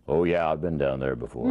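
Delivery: low, even, underplayed delivery; low movie-star talk. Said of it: "They talk so low".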